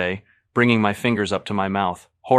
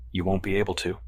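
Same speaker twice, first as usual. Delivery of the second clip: plain reading voice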